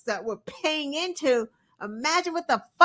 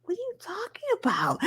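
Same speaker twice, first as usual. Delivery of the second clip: incredulous; 12 semitones higher, 5 dB quieter, faster than usual. Retold or imitated. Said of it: "I'm like".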